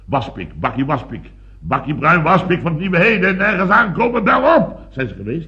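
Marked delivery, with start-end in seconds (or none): 1.6-4.8 climbing to high, loud commands; urgent orders. Acted. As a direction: build to a frantic pitch. Frantic orders over suspected safe burglary.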